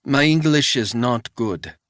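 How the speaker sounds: put-on wary old housekeeper in a thick Swiss accent; old housekeeper questioning a stranger, thick Swiss accent. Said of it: "in a thick Swiss accent".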